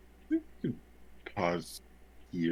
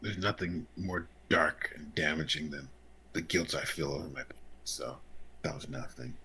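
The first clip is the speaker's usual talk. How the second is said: brooding whisper; 9 semitones lower, 12 dB quieter than usual. Acted. Brooding character line, whispered start.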